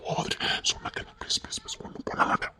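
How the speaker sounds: begging whisper; whispered pleading. Acted. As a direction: plead softly under your breath. Husband begs in whispers.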